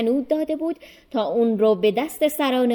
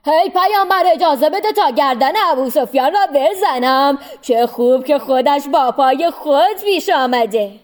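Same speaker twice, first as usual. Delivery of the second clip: loud, high-pitched demand for an execution; furious shout; 4 semitones higher, 7 dB louder than usual. A narrator's reading. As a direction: cry out as you charge in, sword drawn. Omar rushes in, sword drawn. (فریاد خشمگین و پرهیجان)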